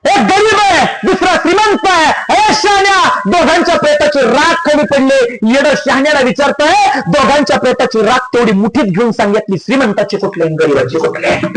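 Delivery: high, ringing sermon storytelling; impassioned preaching. Real, not acted. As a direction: launch high and drive the parable on, easing down at phrase ends. (आवेशपूर्ण kirtan)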